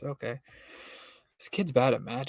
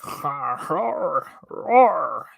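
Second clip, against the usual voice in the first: snarl; 2 semitones higher, much slower than usual. Acted. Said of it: "Ginny snarled".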